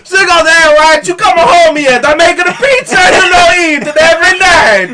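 very high, loud, shouted Italian mamma; mock Italian accent. Wife nagging about pizza, shouted in a hammed-up Italian accent. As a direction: screech it like an angry cartoon Italian wife. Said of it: "That's not how Ka".

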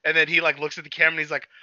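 normal talking voice

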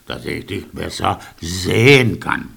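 low wolf voice. A narrator's reading. Wolf answers the girl.

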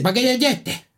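high mock Canarian accent; high, exaggerated Canarian accent; Canarian customer. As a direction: imitate a hard-to-follow Canarian customer. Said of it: "y entra un fulano y dice".

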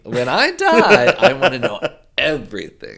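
laughing declaration. Real, not acted.

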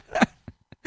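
drawn-out words breaking into laughter; high, slow, cracking up on the words; real laughing. Real, not acted. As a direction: stretch it out helplessly through laughter. Cracking up at own punchline.

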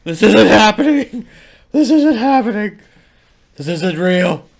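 loud, high hammed-up wailing; high-pitched comic shouting; mock panicked denial. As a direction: yell it like a badly faked victim in denial. Repeats the acted denial routine.

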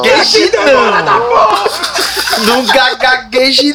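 drawn-out teasing stammer, laughing; slow, jokey fake stammering; mock stutter. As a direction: mimic a choked-up co-host, stretching the words. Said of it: "nosso Inca, o querido, engasgou aqui".

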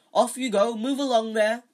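calling out. Acted. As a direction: shoo the crowd along. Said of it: "Lockhart called to the crowd".

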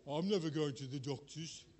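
a hint of a grumpy elder refusing the doctor; stubborn old-timer. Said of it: "I'm not one of those who'll go".

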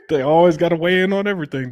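loud, high, talking through a laugh. Real, not acted. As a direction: say it while still cracking up. Jokes after mocking the acronym.

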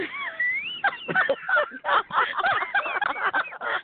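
a long, high, rolling laugh; sustained high laughter. Real, not acted. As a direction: dissolve into laughter. Host laughs after punchline.

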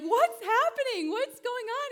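very high, loud, slow astonished cry; amazed cry. Retold or imitated. Healed man dancing, crying out very high and loud. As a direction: cry out like you can't believe your leg works.

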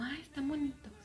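light, high little coo of approval; mock admiring. Mom admiring the pants the aunt shows her, mock admiring.

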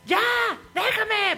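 high mock yell of a boy; childish shriek of protest; imitated child yelling. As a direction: yell it like the kid at the window. Boy shouting from window.

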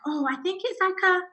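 high, hesitant guess at an answer; imitated uncertainty, lifted in pitch; unsure customer. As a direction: voice a lady who isn't quite sure. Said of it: "And she's like".

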